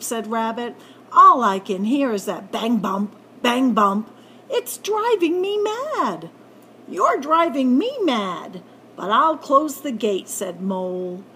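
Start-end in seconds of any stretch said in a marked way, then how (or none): 1.1-6.3 exasperated shout. Acted. Rabbit fed up with the banging gate, loud fed-up cry. 6.9-10.3 louder, exasperated snap; irritated retort. Acted. Mole fed up with Rabbit, irritated retort.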